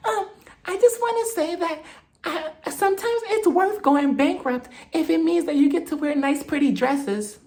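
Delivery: mock her in a high, dreamy voice; Whitney impression. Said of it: "Whitney's just like".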